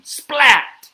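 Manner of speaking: loud, high, sharp splats; lightning splats. Acted. Reader makes lightning for kids, sharp loud splats. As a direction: crack each one out like lightning. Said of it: "He makes lightning".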